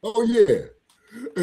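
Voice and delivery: high, laughing "oh yeah". Real, not acted. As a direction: laugh it out, rising. Laughing at the heat joke.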